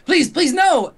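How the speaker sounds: high, loud frantic begging; terrified scientist begs for mercy. Retold or imitated. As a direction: plead for his life. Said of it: "I think he's like".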